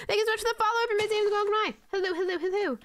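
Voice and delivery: high-pitched, singsong greeting; high streamer thank-you voice; bubbly follower thanks. Real, not acted. Thanking a new follower in routine.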